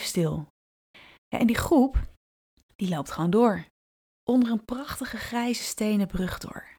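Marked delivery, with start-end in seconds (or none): none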